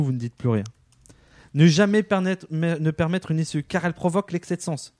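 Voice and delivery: usual speaking voice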